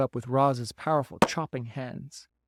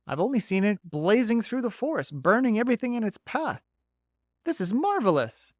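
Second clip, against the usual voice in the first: bright, folksy beaver; a chatty beaver, a bit higher; 8 semitones higher than usual. Acted.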